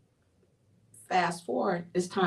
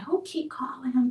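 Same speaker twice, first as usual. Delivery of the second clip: whisper; 7 semitones higher than usual. Retold or imitated. Retelling her upset suspicion.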